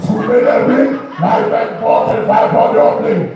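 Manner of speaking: mock Jamaican patois patter; comic Jamaican accent, as if the Queen spoke it; Jamaican patois parody. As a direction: put on an exaggerated Jamaican accent. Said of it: "never heard Queen Elizabeth talk about".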